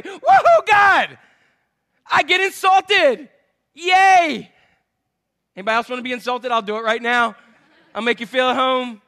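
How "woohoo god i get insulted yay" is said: high, loud whooping praise; mock rejoicing. Preacher thanks God for insults, loud mock joy. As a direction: cheer as if insults were a prize.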